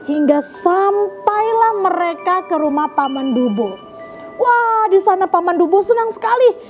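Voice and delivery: ordinary delivery, nothing marked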